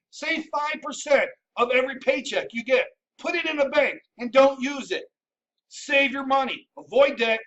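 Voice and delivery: ordinary talk, nothing marked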